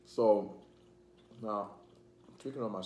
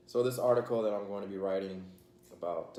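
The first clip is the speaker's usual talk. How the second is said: usual speaking voice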